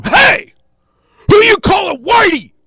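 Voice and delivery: high, loud confrontational shout; violent challenge. Acted. Fed-up man confronting his heckler, violent shout. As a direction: bellow it like you're squaring up for a fight. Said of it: "I looked violent".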